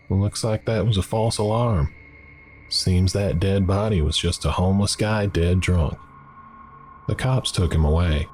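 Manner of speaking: a lightly voiced Southern waitress gossiping; delicate southern accent. Southern waitress gossips to customer, light Southern lilt. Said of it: "in a delicate southern accent".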